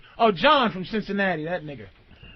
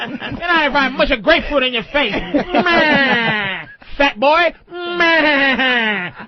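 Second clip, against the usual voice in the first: mocking old-movie gangster voice with drawn-out bleats; Edward G. Robinson; much slower than usual. Host teasing a woman about her voice, gangster caricature. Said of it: "Robinson voice with titties".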